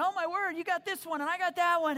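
high, lively excited voices; excited chatter. Retold or imitated. Disciples laughing at new languages, excited and high. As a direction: bubble over with delight.

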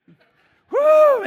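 very high, loud whoop. Retold or imitated. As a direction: let out a thrilled woo. End of coaster ride.

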